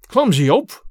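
irritated old father, lightly voiced; angry old man. A narrator's reading. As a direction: snap at the clumsy son. Old man snaps at son.

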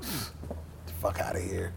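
whispered, dismissive pfft; scoffing whisper. Retold or imitated. Telling Rick Rubin his prediction is nonsense, whispered scoff. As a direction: brush it off under your breath.

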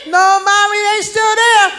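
put-on high little kid voice; child telling mom they're still there; kid answering.

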